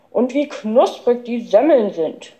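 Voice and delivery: words round a bite of roll; growled while chewing. A narrator's reading. Boy praising the rolls mid-bite, chewing. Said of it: "brummte der Professor kauend".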